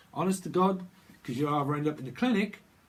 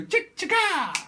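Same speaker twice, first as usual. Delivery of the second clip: squeaky, very high mock voice; imitating him; 7 dB louder, slower than usual. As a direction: go way up for a silly imitation. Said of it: "And he's go".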